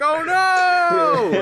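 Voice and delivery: loud, high mock horror; a hammed-up cry of dismay. Mark regrets after watching.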